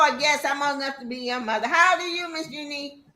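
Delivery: normal talking voice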